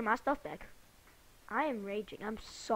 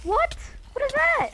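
loud, much higher startled cry; startled shout; 13 semitones higher, 8 dB louder than usual. Real, not acted. Gamer shouting in shock at a sudden monster, loud and high. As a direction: shriek in real surprise.

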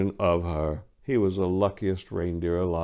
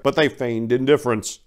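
usual speaking voice

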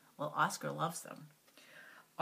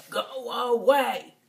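loud, slow grouch command; grouchy; 3 semitones higher, 9 dB louder, much slower than usual. Acted. Oscar's sign telling everyone to go away, loud and slow. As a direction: growl it out slowly.

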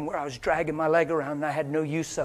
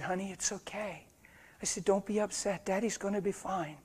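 comforting; 3 semitones higher, 8 dB quieter than usual. Retold or imitated. Comforting his crying daughter.

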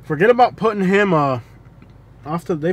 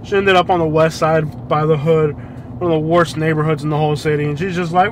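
normal talking voice

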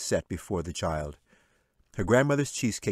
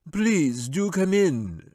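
slow, loud, higher wizard welcome; mocking wizard; 11 semitones higher, 7 dB louder, much slower than usual. A narrator's reading. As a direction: drawl it with mock courtesy. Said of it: "said the wizard".

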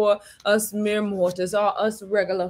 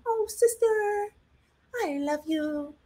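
very high, slow, syrupy sweet; sweet as pie; 13 semitones higher, 5 dB quieter, slower than usual. Retold or imitated. Follower sweet-talking, very high slow syrupy voice. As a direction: fake sugary affection. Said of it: "Act sweet as pie".

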